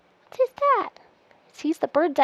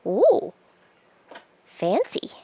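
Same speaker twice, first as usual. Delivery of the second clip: plain reading voice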